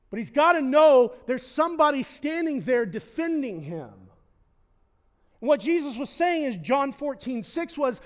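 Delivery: loud, high, driving sermon climax; full-force preaching. Acted. Pastor preaching to the congregation about the protecting shepherd, loud and fervent. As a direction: build the passion and press it home.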